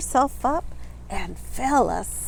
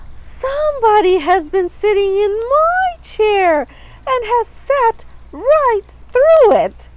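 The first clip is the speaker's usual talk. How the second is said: much higher tiny crying voice; little wee bear voice; 11 semitones higher, 7 dB louder than usual. A narrator's reading. Little bear wails to his parents, high tiny cry. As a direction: squeak it out like a tiny upset cub. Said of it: "cried the little wee bear in his little wee voice".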